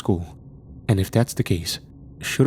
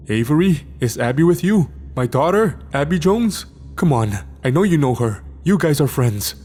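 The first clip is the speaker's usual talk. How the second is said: higher, louder, urgent demand; 6 semitones higher, 3 dB louder than usual. A narrator's reading. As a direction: demand it in a frightened rush. Parent fearing for daughter.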